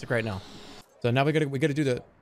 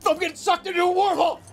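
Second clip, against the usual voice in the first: high, fast cry of alarm; mock panic; 11 semitones higher, 4 dB louder, faster than usual. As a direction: yelp it out in a hurry. Game mishap, crying out in alarm.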